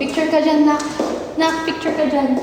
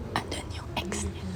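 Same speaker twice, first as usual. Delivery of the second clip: hushed gossipy whisper; 5 semitones lower, 19 dB quieter than usual. Real, not acted. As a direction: whisper the secret.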